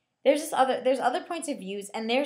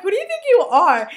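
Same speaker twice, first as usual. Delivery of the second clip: loud, high, incredulous challenge; hammed-up affronted outburst; mock indignation; 10 semitones higher, 11 dB louder than usual. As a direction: call out a puffed-up kid, half laughing. Mock outburst at book's bossy kids.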